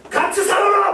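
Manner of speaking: high screaming comeback from the husband; husband's yell. Retold or imitated. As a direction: shout it back at the wife. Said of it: "막 이렇게 얘기해요". (아내에게 소리치는 남편, 높고 큰 고함)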